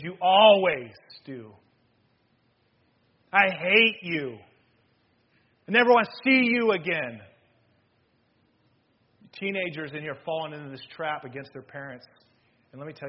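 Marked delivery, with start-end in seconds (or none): 0.0-7.2 loud, drawn-out angry outburst; evil speaking. Acted. Preacher acting out a bitter family fight, angry shouting. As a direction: hurl each word slowly and loudly. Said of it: "Using extremes".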